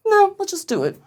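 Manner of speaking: high-pitched dismissive push; insistent overruling. Retold or imitated. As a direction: imitate a wife waving away objections. Wife overruling, acted.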